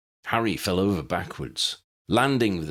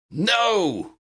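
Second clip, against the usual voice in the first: high, loud bellowed no; Harry bellows as a spider slashes his friend; 14 semitones higher, 4 dB louder than usual. A narrator's reading. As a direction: roar it out at the spider. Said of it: "bellowed Harry".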